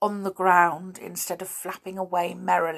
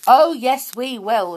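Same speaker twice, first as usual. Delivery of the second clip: high, loud defiant shout; 6 semitones higher, 8 dB louder than usual. A narrator's reading. Pixie defying the Snoogle, shouting. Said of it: "shouted Binky".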